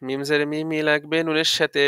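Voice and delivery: ordinary talk, nothing marked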